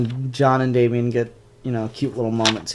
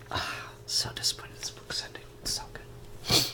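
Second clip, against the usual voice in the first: sad whispered lament; 12 dB quieter than usual. Real, not acted. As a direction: whisper it in disappointment.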